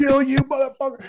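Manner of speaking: high-pitched mock tirade; sweary tirade. Big bodyguards square up to two intruders, mock tirade. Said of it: "a string of F-bombs on a tirade".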